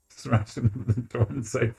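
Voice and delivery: ordinary talk, nothing marked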